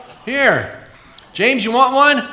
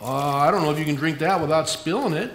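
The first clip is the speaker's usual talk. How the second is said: usual speaking voice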